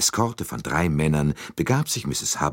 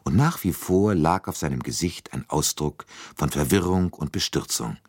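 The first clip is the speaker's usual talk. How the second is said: ordinary delivery, nothing marked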